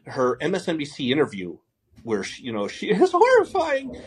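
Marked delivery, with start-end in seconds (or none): 2.7-4.1 breaks into high laughter, drawn out; laughing. Real, not acted. Mocking a reporter's interview, laughing high and slow. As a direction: laugh it out, much higher and slower.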